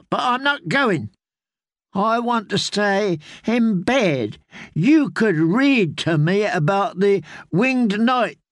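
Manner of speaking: put-on high, slow, stubborn child whine; childish threat. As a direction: dig in like a spoiled boy. Boy lord refuses to go.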